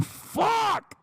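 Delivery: high, strained scream of frustration; exasperated outburst. Acted. Streamer admits he is a mansplainer, screaming in frustration. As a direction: screech the curse in despair.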